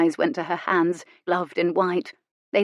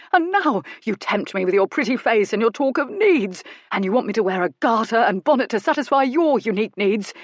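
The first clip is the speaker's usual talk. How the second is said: outraged tirade; 3 semitones higher, 4 dB louder than usual. A narrator's reading. Goodkind denouncing Miss Brightly as depraved, indignant and loud. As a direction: rail at her like a scandalised prude.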